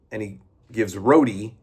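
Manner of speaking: usual speaking voice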